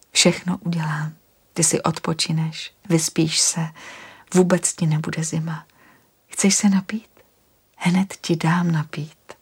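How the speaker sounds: gentle whisper to calm him; soothing whisper. Acted. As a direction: whisper reassurance, unhurried.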